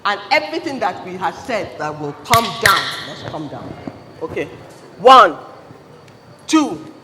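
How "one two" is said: countdown call. Real, not acted. Counting down to the shofar.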